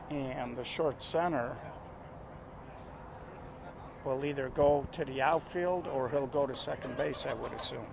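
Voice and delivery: hushed golf announcer voice. Acted. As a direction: keep it soft like at the golf. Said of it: "I'm gonna go to my golf announcer voice".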